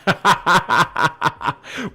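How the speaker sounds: long rhythmic run of laughter. Real, not acted. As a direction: laugh it out in a steady string of ha-has. Laughs after joking with chat.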